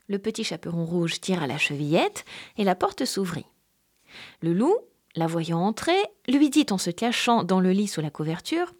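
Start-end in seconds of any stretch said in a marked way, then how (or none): none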